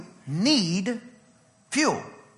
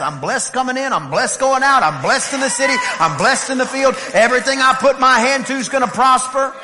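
bold faith declaration; 5 semitones higher, 6 dB louder than usual. Shown as a demonstration. Preacher showing the congregation how kingdom people talk, bold declaration. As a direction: proclaim each blessing louder and louder. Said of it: "the dialect of the kingdom that talks like this".

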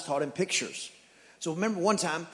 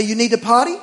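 higher, loud sing-song dog talk; dad chatting up his dog; 5 semitones higher, 7 dB louder than usual. Acted. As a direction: coo at the dog like a baby. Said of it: "I didn't even talk to my kids that way".

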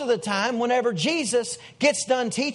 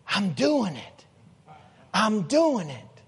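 hushed, slow wonder at his own steps; awed whisper of disbelief; slower than usual. Retold or imitated. Peter stepping onto water.

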